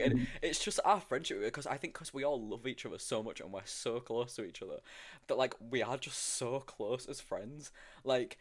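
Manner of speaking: usual speaking voice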